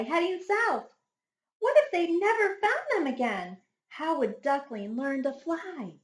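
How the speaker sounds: ordinary delivery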